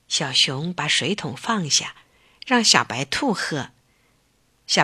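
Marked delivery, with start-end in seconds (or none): none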